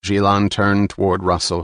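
ordinary delivery

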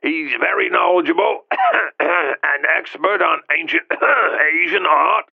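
high croaky voice broken by throat-clearing; hacking cough voice. A narrator's reading. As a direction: hack and clear the throat through it.

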